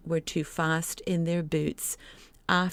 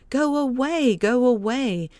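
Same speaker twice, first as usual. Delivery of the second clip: go away shout; 6 semitones higher, 5 dB louder than usual. A narrator's reading. Boy sends creatures away.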